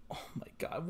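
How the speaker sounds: breathless whispered oh my god; whispered disbelief. Real, not acted. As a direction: whisper it, stunned. Disbelief at twist.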